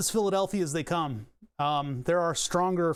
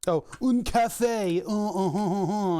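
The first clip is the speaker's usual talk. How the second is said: higher, slow mock French un café; 3 semitones higher, 4 dB louder, slower than usual. Streamer mocking a European in a hammed-up French accent, higher and slow. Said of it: "you fucking European piece of shit".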